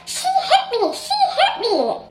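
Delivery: high, laughing mock cry of "she hit me"; mock whiny accuser. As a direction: parody her fake victim wail. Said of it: "the first thing that she said was".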